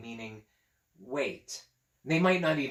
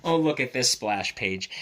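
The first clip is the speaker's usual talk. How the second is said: plain reading voice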